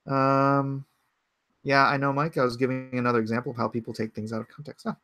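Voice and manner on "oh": normal talking voice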